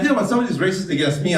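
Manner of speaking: ordinary talk, nothing marked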